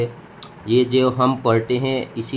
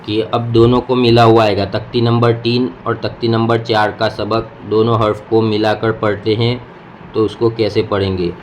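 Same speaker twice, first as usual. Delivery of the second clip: ordinary talk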